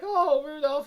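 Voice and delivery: ordinary delivery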